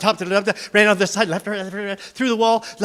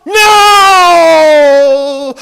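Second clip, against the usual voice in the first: very high, loud shrieking refusal; 10 semitones higher, 16 dB louder than usual. Acted. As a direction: scream it back. Creature shrieks no.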